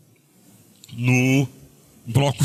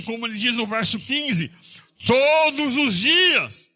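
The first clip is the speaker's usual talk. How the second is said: rising preacher's passion; preaching fervor; 9 semitones higher than usual. Acted. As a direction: build to a fervent cry. Preaching climax about suffering.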